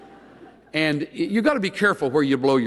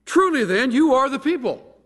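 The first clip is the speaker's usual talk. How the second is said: sarcastic; 11 semitones higher, 4 dB louder than usual. Retold or imitated. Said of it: "having learned a little sarcasm".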